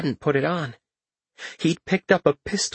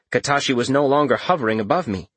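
ordinary delivery

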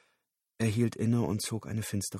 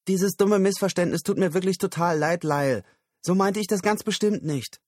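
higher, lightly voiced woman pleading; a hint of Susan saying sorry, pitched up; apologetic woman; 9 semitones higher, 5 dB louder than usual. Susan calling after Lyle, mildly apologetic.

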